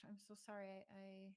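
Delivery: ordinary delivery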